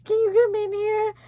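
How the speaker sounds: high mock-tearful call; tearful call for mom. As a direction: imitate calling out to mom while crying. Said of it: "I wake up literally crying".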